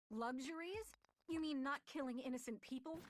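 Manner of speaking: high, loud incredulous outrage; outraged disbelief. Acted. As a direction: throw his word back in disbelief. Karoo outraged at Iago.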